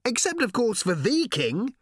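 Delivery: high-pitched calling voice; shouted. A narrator's reading. Ford reasoning aloud to Arthur, shouting. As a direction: call it out over the hooves. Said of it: "shouted Ford".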